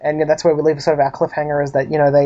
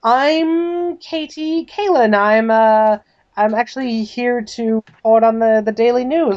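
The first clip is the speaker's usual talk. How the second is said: higher voice, drawn-out introduction; posing as reporter; 6 semitones higher, slower than usual. Acted. Woman bluffs the butler as a reporter, stalling. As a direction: stall on the invented name, pitched up.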